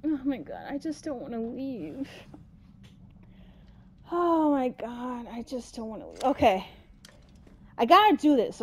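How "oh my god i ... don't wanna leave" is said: very soft, reluctant murmur; hushed dread. Real, not acted. Player not wanting to leave, hushed dread. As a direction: let it sink away in dread. Dreading leaving hiding place.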